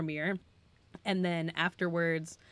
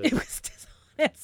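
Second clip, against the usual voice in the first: amused mock repeat, higher and louder; laughing echo; 9 semitones higher, 5 dB louder than usual. Echoing his co-host's verdict with a laugh. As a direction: laugh it back at him in disbelief.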